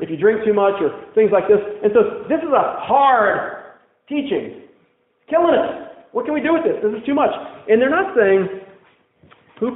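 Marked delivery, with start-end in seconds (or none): 2.3-7.3 complaining disciples. Retold or imitated. Disciples complaining about the teaching.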